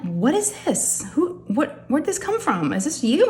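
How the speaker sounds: high-pitched, bewildered questions; astonished questioning. Retold or imitated. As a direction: act out stunned surprise, pitched well up. Acted surprise at finding the gift.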